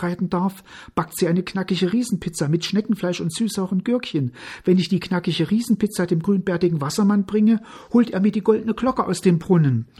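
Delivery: put-on high, hurried boy's voice; pleading little boy. A narrator's reading. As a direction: rattle off the plea urgently and fast. (hohe, hastig flehende Jungenstimme)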